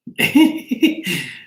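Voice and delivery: short burst of giggles; real giggling laugh. Real, not acted. Laughs at live chat.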